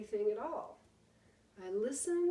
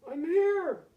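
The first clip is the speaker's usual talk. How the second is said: high loud call from far off; a father calling back to his son; 8 semitones higher, 7 dB louder than usual. Acted. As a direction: answer back across the wood. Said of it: "the voice called out".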